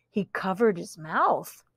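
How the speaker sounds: plain reading voice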